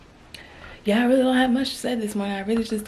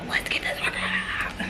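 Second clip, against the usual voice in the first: quiet whisper, slowly; 5 dB quieter than usual. Real, not acted. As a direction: hush it, almost breathless. Whispering in the gym.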